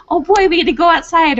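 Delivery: high, bright-eyed dog thinking out loud; happy dog voice. Pretend. Trainer voicing her dog's delight at going outside, high and eager.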